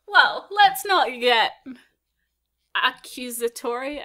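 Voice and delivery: slow dramatic. Acted. Performing the line.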